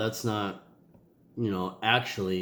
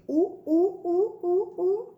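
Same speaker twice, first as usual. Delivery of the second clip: excited hooting; slower than usual. Acted. Cheering Friday for Leah.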